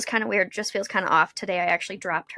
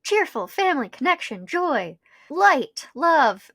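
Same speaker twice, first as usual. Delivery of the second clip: bright, high, sing-song list of holiday words; mock holiday cheer; 6 semitones higher, 4 dB louder, slower than usual. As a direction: gush each word like a greeting card.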